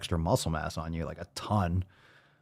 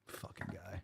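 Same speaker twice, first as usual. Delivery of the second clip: very soft, voiceless grumble; muttered aside; 2 semitones lower, 23 dB quieter than usual. Real, not acted. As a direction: grumble it under your breath. Muttered aside about Doug.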